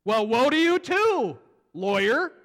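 loud, high, drawn-out denunciation; slow acted shout of condemnation; unloading on them. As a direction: round on them with a booming rebuke. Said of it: "starts unloading on them".

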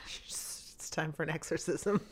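hushed spooky whisper. Pretend. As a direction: breathe it like a secret. Joking whisper in reaction.

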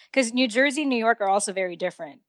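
usual speaking voice

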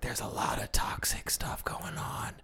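hushed aside; whisper. Real, not acted. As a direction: drop to a whisper. Aside about toxic dating.

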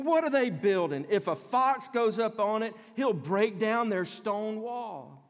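mocking. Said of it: "Tobiah is like".

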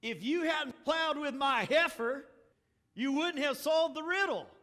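loud, bitter comeback at the thirty guests; indignant retort. Acted. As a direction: hurl it at the cheaters. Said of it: "now hear this one".

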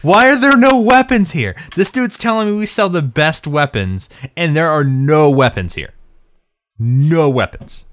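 high, loud, exasperated rant that slows on the last two words; mad rant. Retold or imitated. Player ranting at the empty weapon shop, in a high, loud, outraged yell. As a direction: yell it like a furious player who finds no weapons. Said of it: "I was so mad".

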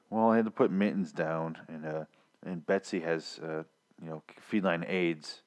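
low, soft, dreary mock lament; sad cat lady. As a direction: drone sadly as a lonely office coworker. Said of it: "sad, lonely cat lady".

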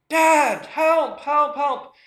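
high mock cry of Dad, help; child yelling for help. Son in the surf calling his dad, high frightened yell. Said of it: "hear Cruz yelling".

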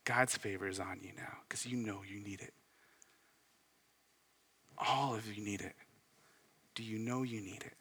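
hushed, breathless whisper to the room. Real, not acted. Pastor whispering God's favor to the congregation, softly. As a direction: lean in and whisper the promise.

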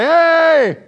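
a loud, high shout bursting out of the cave; sudden shout. Pretend. David stepping out of the cave to call Saul: loud high shout. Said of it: "to then come out of the cave and be like".

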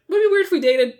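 very high mocking voice; mock Randy. Podcaster mocking the boy's question, very high and squeaky. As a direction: pitch it up to make fun of him.

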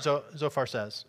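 normal talking voice

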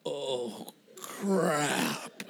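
drawn-out, quiet slow-motion dread. Retold or imitated. Man realizing everything's going wrong, slow-motion groan. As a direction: stretch each word like film slowed down. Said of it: "it all goes into slow motion".

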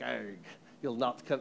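low, soft, hammed-up Irish accent. Irish kids guarding their neighborhood entrance, mock Irish accent. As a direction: do your best mock Irish, pirate-like. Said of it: "that's my best Irish accent".

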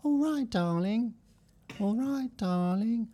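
sing-song the catchphrase. Acted.

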